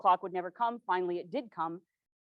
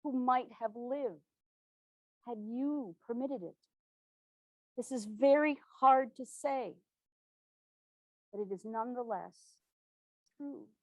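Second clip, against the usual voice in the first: grave and hushed; 3 semitones higher, 3 dB quieter than usual. Acted. Hard truth told to president.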